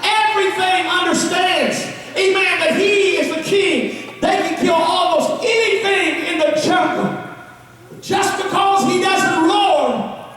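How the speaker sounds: rising preacher's shout, slow on the roar; preaching at full force. Acted. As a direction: build it like a revival preacher.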